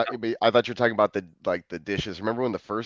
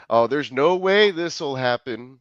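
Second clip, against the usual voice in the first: pleading; 3 semitones higher, slower than usual. Retold or imitated. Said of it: "I super do the".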